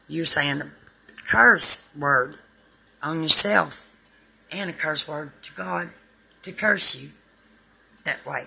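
loud, fervent street preacher; a full-voiced revival sermon; preaching at full force. Preaching hard to camera.

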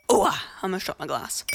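normal talking voice

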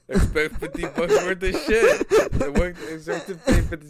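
cracking up mid-joke; laughing. Real, not acted. Cracking up at his own joke to his friend, laughing loudly.

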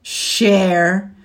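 loud scornful retort; scornful bark. Acted. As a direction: spit the word out in scorn. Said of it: "Scorch belched".

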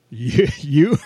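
incredulous, loud; mock disbelief. Real, not acted. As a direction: scoff in playful disbelief. Host mocks cohost deep thought.

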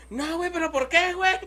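high, whiny protest, imitating a drunk buddy; mock whiny friend. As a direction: squeak out the friend's indignant question. Mimics friend protesting again. (imitando a un amigo quejumbroso con voz aguda)

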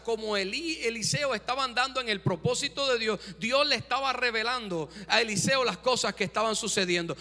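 ordinary talk, nothing marked

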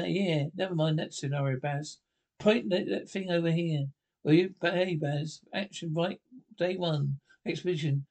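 usual speaking voice

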